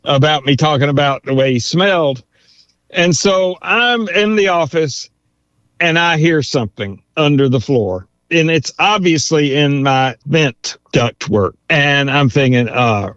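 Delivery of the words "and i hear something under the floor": ordinary talk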